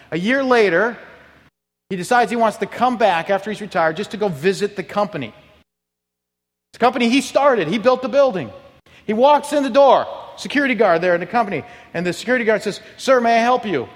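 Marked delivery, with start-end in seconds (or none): 0.1-3.5 lifted, louder, building up; rising preacher intensity. Real, not acted. Repeat, higher. 6.7-13.9 high, loud preacher climb; preaching at full force. Real, not acted.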